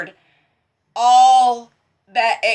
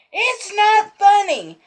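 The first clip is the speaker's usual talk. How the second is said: high, slow mock whine; husband sulking at her laughter, mock whine; husband's complaint; 11 semitones higher, slower than usual. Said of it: "I was laughing at him".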